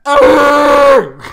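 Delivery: very loud, high zombie roar; imitated zombie scream. Zombie staring down Will Smith, loud imitated scream. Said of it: "looks at Will Smith and just goes".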